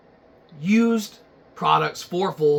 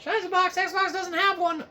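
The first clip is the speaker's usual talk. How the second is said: high, whiny fan voice; a know-it-all viewer correcting the host; nitpicking viewer; 13 semitones higher than usual. Acted. Viewer correcting Shiza Box in a high whiny voice.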